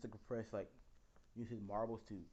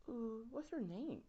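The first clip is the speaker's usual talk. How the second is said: high, slow flirty coo; flirty Toga; 12 semitones higher, slower than usual. Retold or imitated. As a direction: draw it out sweetly like a crush.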